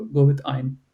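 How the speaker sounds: ordinary talk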